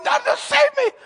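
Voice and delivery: very high squeal for help; hammed-up high-pitched panic; panicked disciples. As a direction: squeak out a mock terrified plea. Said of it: "all the disciples are freaking out".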